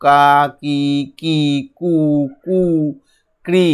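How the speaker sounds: "কা কি কি কু কু ক্রি" chanted as long, separate syllables; syllable drill. Shown as a demonstration. Teacher drills pupils on blended sounds, "কা কি কি কু কু ক্রি" one by one. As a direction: pause after each of "কা কি কি কু কু ক্রি". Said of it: "আরও পাঁচবার পড়ব".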